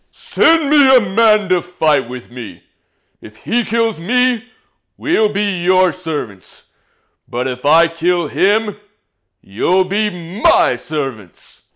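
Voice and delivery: slow, emphatic taunting proclamation; giant's challenge. Acted. Giant challenging an army to single combat, slow booming challenge. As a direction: bellow it slowly, word by word. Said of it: "come out and yell at 'em".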